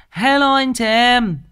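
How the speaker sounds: high, loud, stretched-out hello to everyone; big loud greeting. Real, not acted. Host calling out a big hello to viewers, loud and high. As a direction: call out to the whole crowd, slow and bright.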